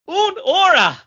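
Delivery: grand mock Italian beer name; Godfather Italian. Shown as a demonstration. Asked to read the beer name in Godfather Italian. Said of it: "now let's hear your Godfather one".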